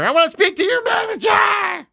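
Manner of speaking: shrill high mock yell, way up in pitch; a hammed-up Karen screech; mock entitled complainer. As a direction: squeal it like someone demanding the manager. Mocking players who complain.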